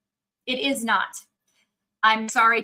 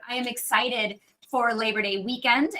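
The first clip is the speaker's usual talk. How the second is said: ordinary talk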